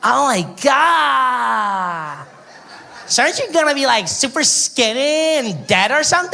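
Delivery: put-on high, gasping valley girl; mock shocked girl. Tourist girl squealing at the comedian in disbelief. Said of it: "She's like".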